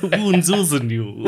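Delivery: high, slow relishing delivery; performed on request. Shown as a demonstration. Co-host asked to say the phrase, hammed up. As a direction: stretch it out with ghoulish ceremony. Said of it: "I just want to hear you say wounds ooze anew".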